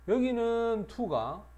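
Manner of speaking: 여기는을 높고 크게, 느리게; 주의를 끌듯 높여 힘주어; 강하게 강조. Shown as a demonstration. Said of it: "강조 설명". (여기는에 강한 강조)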